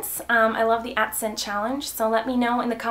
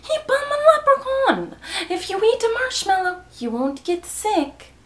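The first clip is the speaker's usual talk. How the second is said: high-pitched mock Irish, slowing at the end; hammed-up Irish lilt, starting very high; Irish accent; 7 semitones higher than usual. Shown as a demonstration. Doing the accent challenge for fans, high mock Irish accent.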